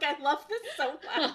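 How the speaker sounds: gushing through a laugh. Retold or imitated. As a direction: laugh while saying it. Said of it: "I was like".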